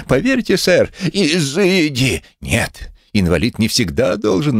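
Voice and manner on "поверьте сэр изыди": slow, drawn-out mocking izydi; mocking echo. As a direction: stretch out izydi as mockery. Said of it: "позвольте тому, что искренне сочувствует".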